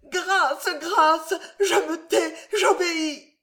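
very high, desperate pleading; begging for mercy. A narrator's reading. Mother begging the captor for mercy, high and desperate. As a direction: beg him to spare her, near breaking.

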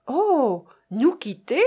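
a pained, startled "oh" into a rising question; exclaimed dismay. A narrator's reading. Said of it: "s'écria Annette".

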